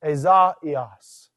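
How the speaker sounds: "Ezaias" with a and i as separate sounds; "Ezaias" carefully divided between the vowels; vowels separated. Shown as a demonstration.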